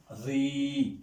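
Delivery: "ri" with the i held long and level; long kasra vowel. Shown as a demonstration. Teacher showing kasra with a vowel letter, "ri" drawn out. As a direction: stretch the vowel of "ri". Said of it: "le kasra avec le voyelle".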